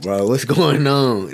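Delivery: slow, high, laughing disbelief; baffled reaction. Retold or imitated. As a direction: act thrown off by an accent, laughing. Confused reaction to Boston accent.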